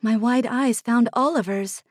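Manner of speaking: plain reading voice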